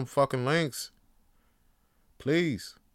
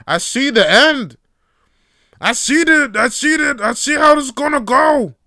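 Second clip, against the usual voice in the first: higher and much louder, shouting a warning; alarmed shout; 12 semitones higher, 14 dB louder than usual. Real, not acted. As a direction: yell it like a frantic prophet of doom.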